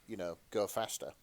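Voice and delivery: usual speaking voice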